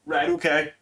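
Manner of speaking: ordinary talk, nothing marked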